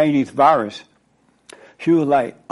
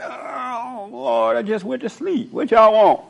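groggy Christ; 7 semitones higher than usual. Retold or imitated. Christ woken by the disciples, high groggy complaint. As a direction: whine like someone roused from a nap. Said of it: "he's like".

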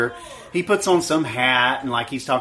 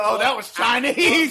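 mocking quote; 9 semitones higher, slower than usual. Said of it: "and you go".

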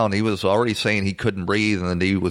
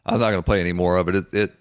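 usual speaking voice

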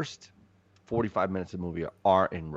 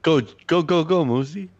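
louder, higher cheer for Moosey; 9 semitones higher, 7 dB louder than usual. Real, not acted. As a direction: hype up your buddy. Cheering friend on for plug.

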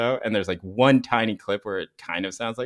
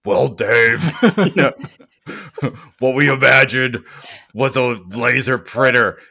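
slow, louder, put-on deep pitch voice; Elizabeth Holmes deep voice; 3 semitones higher, 8 dB louder, slower than usual. Mocking the founder's pitch, a slow put-on deep voice. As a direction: play the self-serious founder, slow and weighty. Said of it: "the Elizabeth Holmes deep voice thing".

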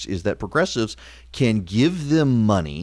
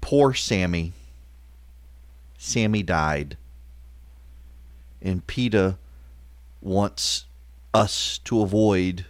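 mock mourning; 4 semitones lower, 7 dB quieter than usual. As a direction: feign grave sorrow, softly. Mock mourning for dead donkey.

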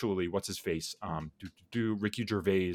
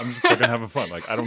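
laughing through the words, much louder; 3 semitones higher, 8 dB louder, faster than usual. Real, not acted. Telling the cohost about his tweets, laughing loudly. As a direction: blurt it out mid-laugh.

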